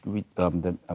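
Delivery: ordinary delivery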